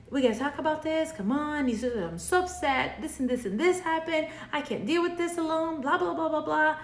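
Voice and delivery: hammed-up anxious partner venting; frantic pursuer. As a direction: rush it all out like an upset partner who needs to talk. Pursuer pouring out upset feelings.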